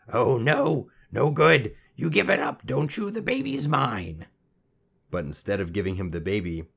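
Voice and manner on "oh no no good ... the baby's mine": high, triumphant imp, slowing at the end; gloating. A narrator's reading. Little man claiming the baby from the queen, gloating. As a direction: crow over the victory.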